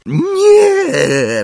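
piercing, high long scream; Umbridge. A narrator's reading. Umbridge is seized by centaurs, piercing scream. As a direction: scream it in terror. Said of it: "rozległ się rozdzierający krzyk Umbridge".

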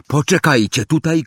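wołanie przez pokój, głośniej i wyżej; zawołaj do chłopców i biegnij; okrzyk. A narrator's reading. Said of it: "krzyknęła". (Hermiona woła do chłopców, krzyk)

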